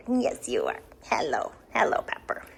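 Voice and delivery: low soft whispered cooing. Real, not acted. Owner whispering hello to puppy Pepper. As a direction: hush it close to the puppy.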